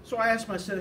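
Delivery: usual speaking voice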